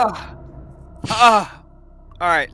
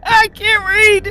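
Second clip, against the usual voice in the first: slow, high, loud, teary laugh-talk; laughing so hard it turns to tears; crying with laughter; 12 dB louder, slower than usual. Real, not acted. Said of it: "got tears streaming out of my freaking eyes".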